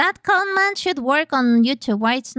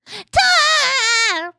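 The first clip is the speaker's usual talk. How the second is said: excited high voice; 10 semitones higher, 3 dB louder than usual. Real, not acted. Excited streamer reacting to game.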